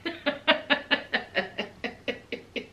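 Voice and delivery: soft stifled staccato snicker; long run of hushed mock giggles; mimicked audience snickering. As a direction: imitate onlookers quietly cackling. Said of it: "everybody sitting there like this".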